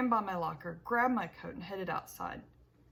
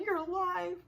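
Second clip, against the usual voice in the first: much higher, louder cry of joy; elated shout; 10 semitones higher, 4 dB louder than usual. Acted. Boy hugging his father in the car: elated shout. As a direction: burst out with relieved delight. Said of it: "I shouted".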